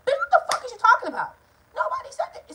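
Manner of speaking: sharp high incredulous yell; outraged shout. Real, not acted. Ranting at her mother's lie, shouting in disbelief.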